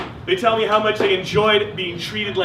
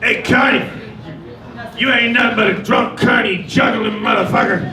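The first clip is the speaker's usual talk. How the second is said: put-on drunk heckling bums; 3 semitones higher than usual. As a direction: jeer like a drunk bum. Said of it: "They're like".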